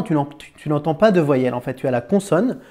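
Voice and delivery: ordinary talk, nothing marked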